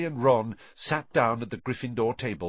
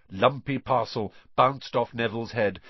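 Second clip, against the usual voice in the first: plain reading voice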